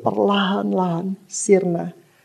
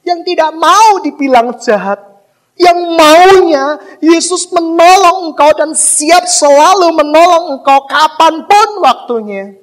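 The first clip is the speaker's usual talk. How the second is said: much louder and higher, stretched out; preaching shout; 8 semitones higher, 9 dB louder than usual. Acted. Preacher rebukes believers who only want help, loud shouting climax. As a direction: cry it out over the congregation.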